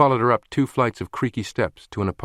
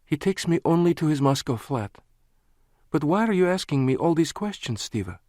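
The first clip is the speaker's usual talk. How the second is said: higher, wary woman's voice; suspicious woman; 9 semitones higher than usual. A narrator's reading. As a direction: narrow your eyes, probing. Lana, suspicious.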